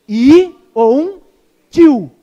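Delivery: preacher drives home that not one letter falls; stress on "I ou um" and "tio". Acted. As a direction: hit "I ou um ... tio" hard and slowly. Preacher stressing scripture's smallest letter.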